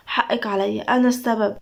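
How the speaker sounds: ordinary delivery, nothing marked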